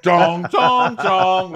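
high loud ding-dong chime; doorbell imitation. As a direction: sing it like a doorbell.

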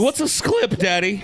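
put-on high sing-song child asking daddy; little kid voice. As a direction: innocent little kid question.

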